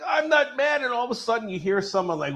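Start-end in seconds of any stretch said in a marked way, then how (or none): 0.0-0.8 much higher, yelled denial of being mad; mock angry shout. Bruce insisting to the doctors he isn't angry, yelling. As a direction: bark it out, plainly furious. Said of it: "He's like".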